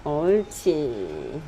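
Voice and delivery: low, very slow praise; praising a dog. Real, not acted. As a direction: draw it out low and soothing. Praising dog after it waits.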